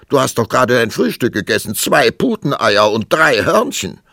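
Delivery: loud, high, rattled-off reproach; rasping scolding. A narrator's reading. King scolding queen about her breakfast, rasping loudly. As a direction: reel it off scornfully at full volume. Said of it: "schnarrte der König".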